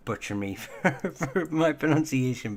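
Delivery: amused, breaking into a laugh mid-sentence. Real, not acted. Telling purists out there they would butcher the pronunciation, laughing. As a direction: laugh through the words, pitch lifting.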